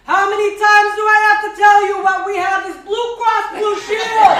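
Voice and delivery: loud, high exasperated shout; husband shouting his answer through the door; yelling back. Retold or imitated. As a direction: bellow it back from the other room. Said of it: "And George yells back".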